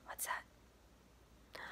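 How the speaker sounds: hushed suspense; whisper. Real, not acted. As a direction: whisper to build the mystery. Hushed suspense over page.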